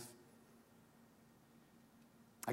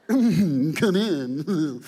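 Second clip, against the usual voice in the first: loud, slow East Texas drawl as Satan; Satan's East Texas drawl; 4 semitones higher, 11 dB louder than usual. Retold or imitated. Pastor voices Satan inviting buyers, East Texas drawl. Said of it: "I don't know why Satan had a East Texas drawl".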